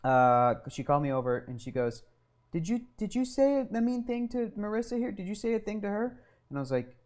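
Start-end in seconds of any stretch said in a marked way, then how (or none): 2.5-6.2 high, put-on teacher voice; questioning teacher. Teacher asking a child about a mean remark, high put-on voice. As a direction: pitch it up to question a small child.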